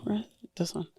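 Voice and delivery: very soft, near-whispered aside; muttering to oneself. Real, not acted. Gift giver looks for the right stone, muttering. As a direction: murmur it while sorting through things.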